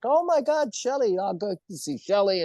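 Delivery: high-pitched retold excitement; excited exclamation. Recalling his reaction to seeing Shelley, excited. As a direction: exclaim in delight over an old friend.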